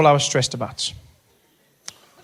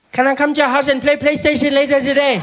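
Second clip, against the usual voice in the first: little brother; 12 semitones higher than usual. Retold or imitated. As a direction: voice a small boy asking hopefully. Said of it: "He's like".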